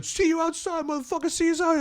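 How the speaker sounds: high, mock-threatening see you outside; threatening drunk. Thrown-out boyfriend threatens the comic, high mock menace. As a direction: taunt him from the door. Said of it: "He's going".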